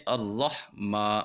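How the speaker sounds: اللہ ما slow, ما held long, not nasal; correct recitation. Shown as a demonstration. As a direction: recite اللہ ما slowly, the ما kept clear in the mouth. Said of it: "کیسے پڑھیں گے؟".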